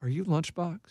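soft, quiet question; imitating a stranger asking quietly; retold discreet question. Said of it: "she goes".

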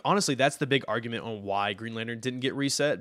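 ordinary delivery

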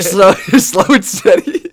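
laughing and slowing down on purpose. Real, not acted. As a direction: drag it out, giggling. Joking about learning.

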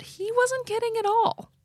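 high, sudden ominous turn; spring the twist on them; dramatic reveal. Retold or imitated.

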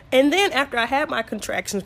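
ordinary talk

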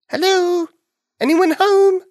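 high, loud call into a room. Acted. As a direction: call out to see who's there. Calling into hen house.

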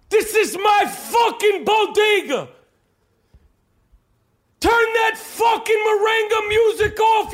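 high-pitched, drawn-out angry yelling; hammed-up screaming tirade, words stretched; furious shouted rant. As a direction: yell abuse across a noisy store. Acted rant at bodega worker.

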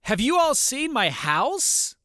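put-on high, slow, eager child asking; imitating a proud little kid showing off; boy asks strangers on the street about his house, in an imitated high child voice. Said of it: "He said".

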